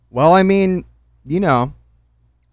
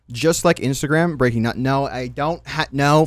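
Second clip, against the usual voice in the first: ordinary talk, nothing marked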